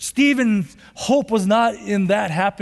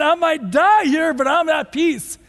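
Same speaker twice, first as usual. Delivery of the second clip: fervent inner cry; 6 semitones higher than usual. Retold or imitated.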